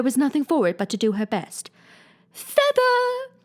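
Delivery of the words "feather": high, loud cry of an odd sheep; shrill sheep character crying an order; sheep's cry. Acted. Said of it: "cried the sheep".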